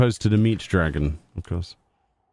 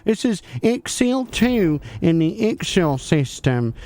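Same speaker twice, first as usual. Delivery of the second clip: higher, bright-eyed 'hi guys' YouTuber hype; hammed-up YouTuber intro voice, pitched up; parody YouTuber voice; 5 semitones higher than usual. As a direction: play an over-eager clickbait YouTuber, guys after every phrase. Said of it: "We'll make our own Easter egg video then".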